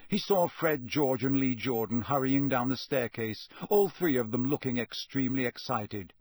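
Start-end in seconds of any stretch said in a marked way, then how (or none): none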